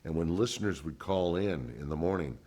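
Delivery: ordinary delivery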